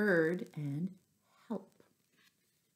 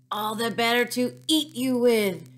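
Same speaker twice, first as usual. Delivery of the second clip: menacing wolf; 5 semitones higher, 7 dB louder than usual. A narrator's reading. Wolf reveals, about to pounce.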